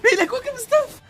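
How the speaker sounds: very high, loud, laughing disbelief; incredulous outburst. Real, not acted. Player baffled by the enemy's items, incredulous squeal. Stunned by opponent in game.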